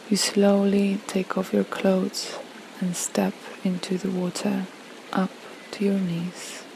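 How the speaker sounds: unhurried relaxation voice, phrase by phrase; guided meditation. Acted. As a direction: gently guide a listener through a peaceful visualization. Leads a guided visualization.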